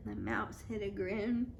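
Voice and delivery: plain reading voice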